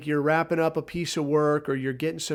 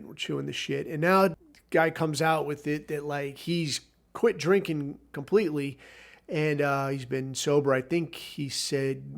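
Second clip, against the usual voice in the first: ordinary talk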